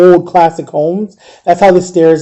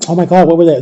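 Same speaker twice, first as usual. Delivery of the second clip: usual speaking voice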